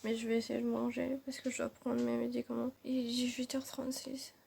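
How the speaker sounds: tired, swollen-mouth mumble; after wisdom teeth. Real, not acted. Exhausted, in pain after surgery.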